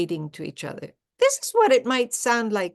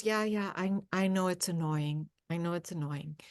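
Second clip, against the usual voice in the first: much softer, calm admission; mother calmly accepting her daughter's jab; 2 semitones lower, 8 dB quieter than usual. Acted. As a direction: concede it quietly without defending. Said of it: "And, and mother goes".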